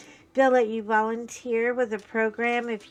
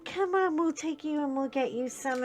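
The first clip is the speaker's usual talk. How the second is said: eager reassurance; 6 semitones higher than usual. Retold or imitated. Cheerful reply to son.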